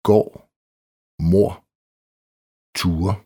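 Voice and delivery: gård, mor, ture without any D sound; silent D. Shown as a demonstration. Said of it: "R D". (stumt D i gård, mor og ture)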